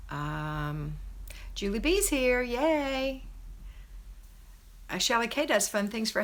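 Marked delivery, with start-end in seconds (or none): 1.6-3.2 happy greeting. Real, not acted. Greeting a viewer arriving live.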